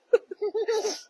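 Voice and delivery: high, real teary giggle; embarrassed teary laugh. Real, not acted. Mother overcome while thanking viewers, teary giggle. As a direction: laugh off the tears.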